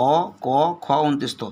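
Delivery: ordinary talk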